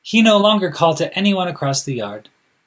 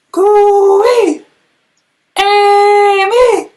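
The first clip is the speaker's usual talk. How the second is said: long high call, much louder and higher; loudest call; 10 dB louder, much slower than usual. A narrator's reading. Louis calling Amy across the world with his loudest call. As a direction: throw your head back and call as loud as you can. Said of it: "His cry rang out across the yard".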